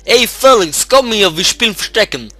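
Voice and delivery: put-on high, loud boy's shout to a friend; excited kid yelling across the yard; calling out. A narrator's reading. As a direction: call a playmate over from afar. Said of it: "ein Junge rief mir zu".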